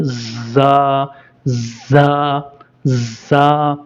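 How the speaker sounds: ज़ा drawn out long; slow Arabic letter. Shown as a demonstration. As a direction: stretch each ज़ा slowly. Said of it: "फिर साथ पढ़ें". (ज़ा धीरे खींचकर)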